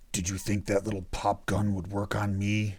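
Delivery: low rumbling demon voice; deep bass rumble. A narrator's reading. Damien mocks the intruder's pistol in a deep demonic rumble. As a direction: let it rumble from the chest. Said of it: "his voice suddenly a deep bass rumble".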